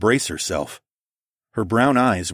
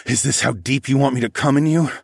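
raised, intense half-growl; mild growl; 6 semitones higher, 4 dB louder than usual. A narrator's reading. Narrator challenging his lover, mildly growling. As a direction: press the question with a touch of growl. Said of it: "I growled".

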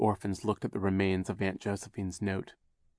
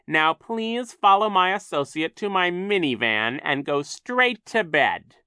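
high, loud, impatient villain; Captain Sham, angry; 10 semitones higher, 8 dB louder than usual. A narrator's reading. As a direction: order the children off to bed. Captain Sham orders children away.

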